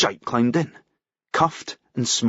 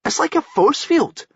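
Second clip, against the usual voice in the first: high, lively explaining voice; Paul's voice; 14 semitones higher than usual. Acted. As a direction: explain it with a lift. Paul explaining Spammy.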